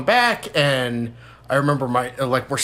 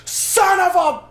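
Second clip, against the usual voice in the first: high, drawn-out mock yell; furious dad; 3 dB louder, much slower than usual. Dad throws his hat in front of everyone, furious yell. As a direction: imitate dad exploding as he hurls his hat.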